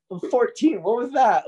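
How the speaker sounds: high, loud disbelief; can't believe what just happened; baffled exclaim. Real, not acted. Recalling the odd stranger, baffled high exclaim. Bewildered teen after stranger's joke.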